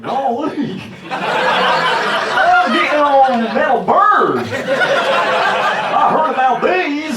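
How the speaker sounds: high, slow, loud yokel voice. Retold or imitated. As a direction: gawk like a first-time flyer. Mocking naive flyer.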